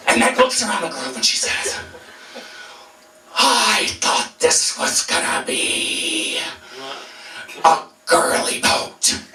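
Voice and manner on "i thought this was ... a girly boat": slow, whispery tough-woman voice; gruff Meg. Retold or imitated. Teller voicing Meg sizing up the group, slow and rough. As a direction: drawl it out like a tough pirate woman.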